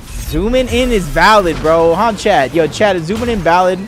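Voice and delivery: slow, loud declaring to chat; loud insistence. Real, not acted. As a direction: proclaim it for the whole stream.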